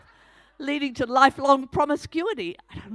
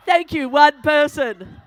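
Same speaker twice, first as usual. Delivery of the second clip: laughing, slow and louder; laughing thanks; 8 dB louder, slower than usual. Real, not acted. Speaker laughing to the one audience member who answered. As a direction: laugh it out, drawn-out.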